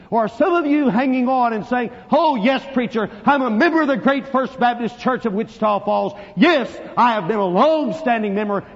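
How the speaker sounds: loud, high, urgent pulpit challenge; full-force preaching. Real, not acted. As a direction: press the congregation at full volume.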